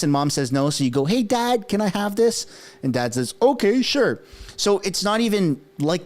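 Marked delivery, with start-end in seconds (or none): 1.0-2.4 put-on higher-pitched kid's request; imitated asking child. Child asking Dad after Mom said no, pitched-up kid voice. 3.4-4.2 high, falling, easy yes; a hint of a parent giving in; lightly voiced agreeable dad. Dad saying yes to the kid, lightly acted assent.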